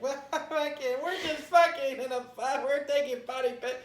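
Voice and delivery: high, loud party-goer babble; imitated drunken excitement. Non-drinker mimicking drunk friends in a photo booth, loud mock excitement. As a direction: whoop it up like a tipsy reveler. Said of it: "you're in a photo booth".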